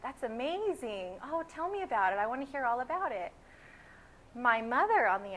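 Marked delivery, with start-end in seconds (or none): 0.0-3.3 put-on fascination with swooping pitch; mock gushing amazement. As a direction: gush as an enchanted stranger would. People gushing over father's origin.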